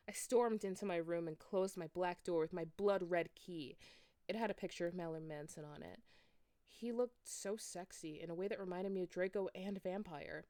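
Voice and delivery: plain reading voice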